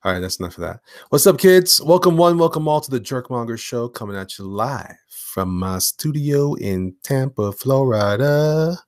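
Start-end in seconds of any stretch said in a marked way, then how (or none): 1.1-8.8 big, loud greeting easing into drawn-out words; show host opener. Pretend. Host welcomes kids to his live show, announcer style.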